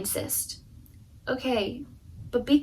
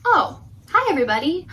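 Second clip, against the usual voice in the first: plain reading voice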